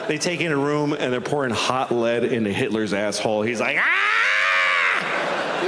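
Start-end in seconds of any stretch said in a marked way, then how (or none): 3.8-5.7 hammed-up agonized cry and wail; mock scream of someone being tortured. Hitler tortured in hell.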